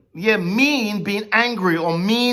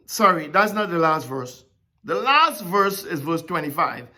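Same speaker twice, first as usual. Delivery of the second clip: ordinary talk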